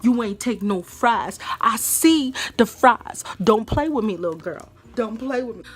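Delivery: loud, slow, heated scolding; getting heated. Real, not acted. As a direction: relive the anger and scold her. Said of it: "I'm starting to get heated already".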